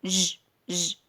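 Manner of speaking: ج as a sustained buzzing zh; sound of J. Shown as a demonstration. Teacher showing how J is pronounced, isolated sound. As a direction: isolate ج as "zh", not the name jay. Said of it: "جي بننطقها".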